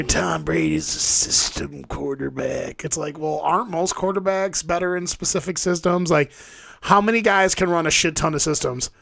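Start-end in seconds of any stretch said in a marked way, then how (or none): 0.0-2.8 slow, drawn-out mock of the critics; mimicking naysayers at a slow pace. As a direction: drag it out like a tiresome hater. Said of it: "you got all these guys out here".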